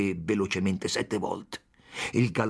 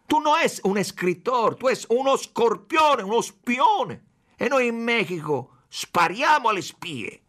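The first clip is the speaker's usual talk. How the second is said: much higher and louder, hammering accusation; furious shout; 11 semitones higher, 5 dB louder than usual. A narrator's reading. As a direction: scream "spione" like a verdict. Chief shouting spy accusations.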